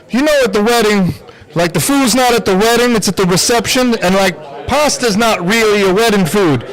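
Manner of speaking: lightly voiced Steve Harvey. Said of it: "Steve Harvey looks over at him".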